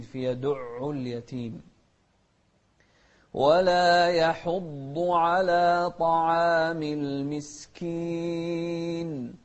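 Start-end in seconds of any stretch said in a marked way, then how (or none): 3.3-9.3 slow, sung recitation; slow sung Quran recitation; melodic Quran recitation. Shown as a demonstration. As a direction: chant it slowly and melodically.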